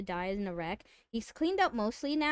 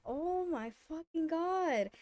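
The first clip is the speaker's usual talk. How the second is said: aghast groan; 6 semitones higher, 5 dB quieter, slower than usual. Retold or imitated. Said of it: "I'm fucking pissed at this point".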